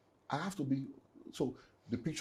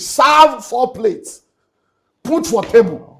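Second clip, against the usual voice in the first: much higher and louder, dragged slow; anguished outcry; 9 semitones higher, 16 dB louder, much slower than usual. Real, not acted. Telling the host how he cooked for absent children, anguished high cry. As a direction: cry it out as if the loss still stings. Grief, cooking for absent family.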